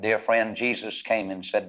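ordinary talk

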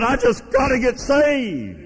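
high, drawn-out imitation of a desperate woman; retold tearful plea. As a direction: plead it high and slow, as if overcome. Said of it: "Tears started coming out of her eyes".

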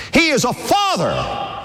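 slow, proclaimed with force; preached emphasis. Real, not acted. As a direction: drive it home to the congregation. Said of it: "preacher repeats line".

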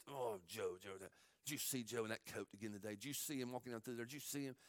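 low, hushed, contemptuous gossip; sneering brothers. Acted. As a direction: mutter about him with scorn. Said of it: "Every day they just".

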